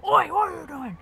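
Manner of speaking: high, loud "oi" thrown over the shoulder; yelling at kids. Retold or imitated. Mum yelling at the kids she thinks made a bang, loud and high. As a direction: snap at the kids.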